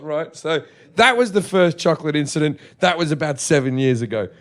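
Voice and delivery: usual speaking voice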